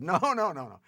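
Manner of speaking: high, loud, insistent no's; emphatic refusal. Retold or imitated. Father refusing Cohen's offer, loud mock protest. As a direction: cut him off hard.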